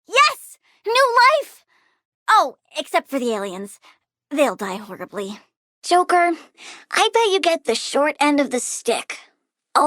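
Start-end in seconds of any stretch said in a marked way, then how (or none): 0.1-1.6 thrilled yelp at new growth; delighted. Acted. 4.3-5.4 dark aside. Acted. Muttered aside.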